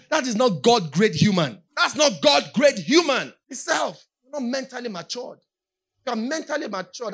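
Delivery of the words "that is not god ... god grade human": slow, loud, emphatic declaration; preacher at full force denouncing selfishness; preaching shout. Acted. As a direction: hammer it home slowly to the crowd.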